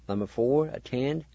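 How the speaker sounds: usual speaking voice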